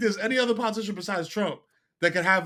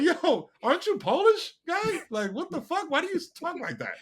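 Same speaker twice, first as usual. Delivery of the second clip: incredulous; 9 semitones higher than usual. Retold or imitated. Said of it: "I was like".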